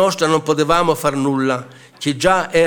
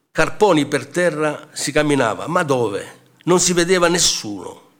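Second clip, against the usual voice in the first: ordinary delivery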